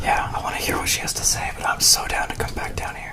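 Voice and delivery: hushed whisper. Real, not acted. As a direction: whisper so nothing hears. Whispering on camera in cellar.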